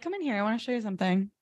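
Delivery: soft, secretive aside; hushed invitation. Pretend. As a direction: beckon someone in quietly. Acted furtive invitation.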